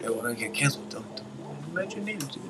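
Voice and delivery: near-silent urgent hush; hushed whisper. Real, not acted. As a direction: warn them under your breath. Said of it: "hushed warning".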